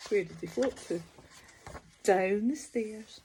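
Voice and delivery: usual speaking voice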